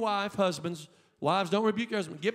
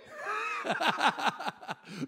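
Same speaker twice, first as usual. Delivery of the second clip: a high, joyful burst of laughter; excited laugh; 8 semitones higher than usual. Real, not acted. Pastor laughing with joy between shouted lines. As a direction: laugh out in delight.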